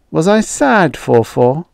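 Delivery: ordinary delivery, nothing marked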